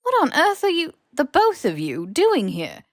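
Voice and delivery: high, loud, demanding professor; indignant older woman; stern headmistress. A narrator's reading. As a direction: bark it at two boys caught out. McGonagall demanding, voiced character.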